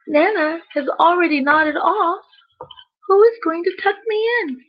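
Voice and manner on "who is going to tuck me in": ordinary delivery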